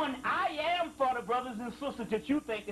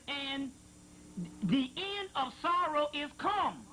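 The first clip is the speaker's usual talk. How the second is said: higher, slow, solemn intoning; scripture reading; 4 semitones higher, slower than usual. Acted. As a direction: draw out each word like prophecy. Reading a sacred passage aloud.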